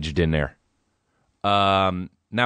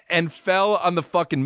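ordinary talk

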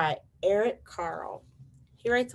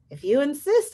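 high, louder reply, lifting at the end; skunk; 9 semitones higher, 7 dB louder than usual. Acted. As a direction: accept politely in a high critter voice. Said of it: "said the skunk".

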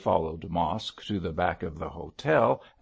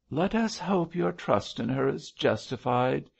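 higher-pitched, mildly doubting sigh; sighing insinuation; 6 semitones higher than usual. A narrator's reading. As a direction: let the doubt hang, lightly sighed. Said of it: "sighed the mischief-maker".

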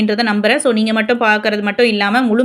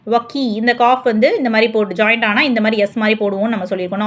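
ordinary talk, nothing marked

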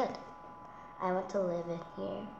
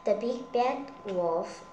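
usual speaking voice

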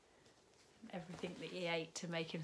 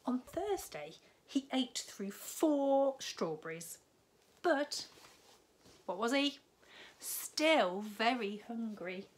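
higher, louder and slower, playing up the story; animated children's story narration; storybook read-aloud, building; 6 semitones higher, 8 dB louder, slower than usual. A narrator's reading. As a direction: build the suspense for the little ones. Reads picture book to children.

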